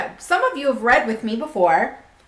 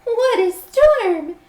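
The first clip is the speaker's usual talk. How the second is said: high, louder birdy twitter; twittering wren; 12 semitones higher, 5 dB louder, much slower than usual. Acted. Wren flying in from the storm, high twittering. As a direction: twitter it like a little bird. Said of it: "twitters wren".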